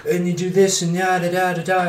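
high mocking filmmaker voice; lofty, high pitched guru parody; J J Abrams voice. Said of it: "here's J J Abrams saying".

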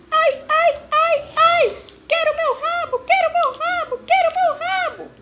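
very high, loud whiny monkey cry; shrill squeaky monkey sobbing for its tail; wailing monkey. A narrator's reading. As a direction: wail like a heartbroken little monkey. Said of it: "bradou o macaco, gemendo e chorando".

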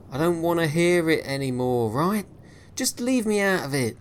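high, loud exasperated sailor; angry shutdown. Acted. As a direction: end the talk for good. Williams shuts him down.

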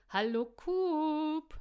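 high, loud sing-song hello; fluting greeting. A narrator's reading. Woman greeting the policeman in the kitchen, fluting. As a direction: trill it out, stretching the name long. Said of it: "flötete Caro".

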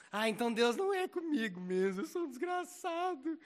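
lightly hammed-up self-pity; mock whiner. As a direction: whine it like a crybaby. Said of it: "os mimizentos normalmente falam assim".

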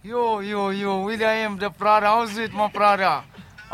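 ordinary talk, nothing marked

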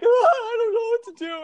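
very high, wailing fake sob; hammed-up tearful panic; mock crying. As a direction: wail it like a crybaby. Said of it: "He's crying".

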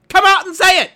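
very high, very loud yelled demand; furious yell. Retold or imitated. Demanding managers admit it, yelling very loud. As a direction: scream the demand at full force. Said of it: "And I'm just like".

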